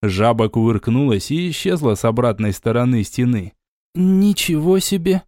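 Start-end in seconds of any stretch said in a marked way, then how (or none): 3.9-5.2 squeezed out after coughing; raspy, strained exclamation; hoarse. Acted. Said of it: "откашлявшись, выдавила Лена". (сипло, после кашля)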